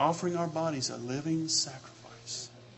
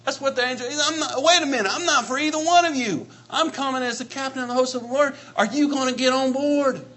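loud, high, pounding preacher cadence; fiery preaching; 7 semitones higher, 9 dB louder than usual. Acted. Preacher exhorting the congregation, fiery and loud. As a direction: drive it home from the pulpit, full force.

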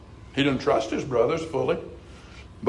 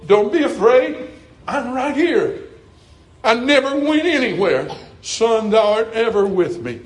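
higher, louder, swelling reassurance; reassuring Lord's voice; 5 semitones higher, 4 dB louder than usual. Acted. The Lord assuring his son, rising and louder. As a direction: build it up like a promise shouted across a storm.

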